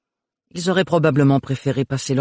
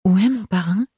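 higher, mildly anxious question; worried question; 8 semitones higher than usual. A narrator's reading. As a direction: ask with a touch of worry. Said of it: "s'inquiéta Ophélie".

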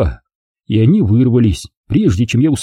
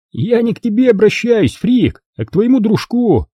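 teen bully voiced a touch higher, sneering; sneering bully Rob; 10 semitones higher than usual. A narrator's reading. Rob taunting John about his friend, lightly voiced sneering bully. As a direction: sneer at Freak as a schoolyard bully.

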